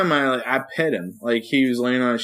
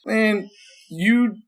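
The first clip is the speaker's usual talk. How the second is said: incredulous, pitched up high at the dog; exasperated scolding; 7 semitones higher, slower than usual. Retold or imitated. Owner scolding his dog for licking itself, pitched-up disbelief. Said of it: "I'm like".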